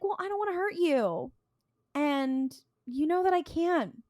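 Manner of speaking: high, put-on reluctant menace; mock sweet threat. Janitor warning Dean off, mock regretful. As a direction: imitate a trickster threatening in a high voice.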